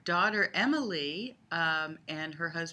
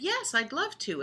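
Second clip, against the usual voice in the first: eager reply; 10 semitones higher, 3 dB louder than usual. Retold or imitated. Grandmother's own line in game.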